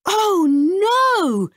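high, loud dramatic gasp; alarmed cry. Acted. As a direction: read it with alarm. Narrator exclaims.

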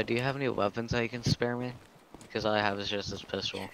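usual speaking voice

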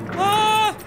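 high loud scream; startled scream. Real, not acted. Player hit by a jump scare, high scream. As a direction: yelp in sudden fright.

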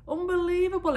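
high, loud exclamation; exclaiming. Acted. Trade minister praising the others, high loud exclamation. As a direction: burst out in fake amazement. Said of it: "exclaimed the trade minister".

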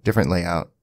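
ordinary talk

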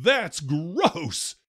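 a boy's disgusted retold yell; mild mock yell of a grossed-out kid. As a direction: blurt it out in horror. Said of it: "He leaps out of the chair and yells".

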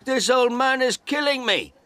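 very high, loud panicked cries; man on the ground yelling for help; shouting for help. A narrator's reading. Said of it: "He shouted".

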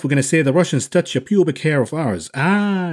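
ordinary delivery

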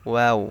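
ওয়াও with both lips rounded; careful demonstration of round-lipped ওয়াও; rounded-lip letter. Shown as a demonstration. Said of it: "দুই ঠোঁট গোল করিয়া".

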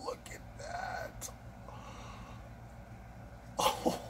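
breathy whisper of amazement; awed whisper. Real, not acted. Admiring the blade for viewers in an awed whisper. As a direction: whisper it like it's sacred.

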